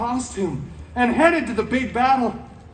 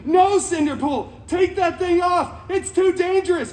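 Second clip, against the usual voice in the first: mock screaming; 7 semitones higher than usual. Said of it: "they just start screaming".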